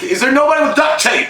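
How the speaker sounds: high put-on voice, exasperated; an exasperated high quip from a movie character; Guardians character impression. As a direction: ask it in a squeaky cartoonish voice. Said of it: "reminded me of the Guardians guys".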